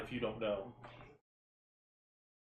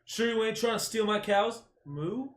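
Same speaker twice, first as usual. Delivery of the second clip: higher, louder joking comeback; mock retort; 9 semitones higher, 3 dB louder than usual. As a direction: toss it off as a cheeky joke. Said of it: "the best reply would have been".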